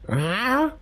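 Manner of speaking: high meow sliding upward; idle cat's questioning meow; lazy cat meow. Acted. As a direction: give a lazy rising mew. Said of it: "just lazily looks over".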